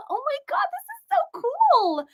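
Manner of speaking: high amazed gushing; thrilled squeal. Real, not acted. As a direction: squeal with delight at the map of viewers. Reading viewer locations delighted.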